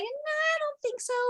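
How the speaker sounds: lofty falsetto brush-off to the king; colonies brushing him off. Retold or imitated. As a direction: toss it off in a lofty, dismissive falsetto. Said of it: "the colonies were like".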